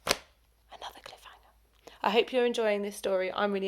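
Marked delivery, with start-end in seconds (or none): 0.1-1.5 slow, dramatic whisper; spooky cliffhanger whisper. A narrator's reading. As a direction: drop to a hushed whisper for suspense.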